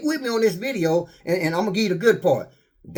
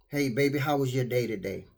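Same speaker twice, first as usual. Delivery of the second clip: softer, lower loving question; tender comfort; 7 semitones lower, 8 dB quieter than usual. Retold or imitated. Husband comforting wife after her day, tender and soft. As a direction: ease your partner after work. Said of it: "To comfort one another".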